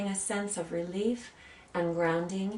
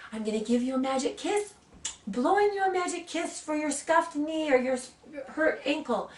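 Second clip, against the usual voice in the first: put-on lilting, higher-pitched mother to a child; imitating soothing mother; 7 semitones higher than usual. Mother to her hurt little girl, imitated comforting singsong. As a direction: coo it like a mum promising a cure.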